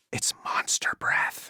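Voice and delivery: slow, hushed whisper, like sharing gossip; whispered aside. Acted. [name] whispers a joke about Strange's breath to Hunter, hushed and conspiratorial.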